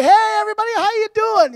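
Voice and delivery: loud, high, boozy hello to everyone; tipsy greeting. Retold or imitated. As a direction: play the cheerful drunk walking in. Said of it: "I was pretty lit up".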